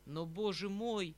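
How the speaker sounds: a despairing exclamation to himself. Acted. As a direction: despair breaking through the words. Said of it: "сказал я в отчаянии".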